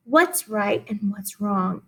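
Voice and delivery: ordinary talk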